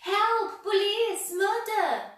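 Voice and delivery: very high, very loud terrified scream. A narrator's reading. Mister Jelly hit by a leaf, screaming high for help. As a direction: scream for help at full pitch. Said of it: "he screamed".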